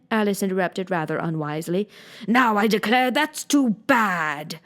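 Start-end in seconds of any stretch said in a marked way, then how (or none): 2.3-4.6 slow, heated outburst; sudden passion. A narrator's reading. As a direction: let the temper flare up, drawn out. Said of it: "cried, breaking into a sudden passion".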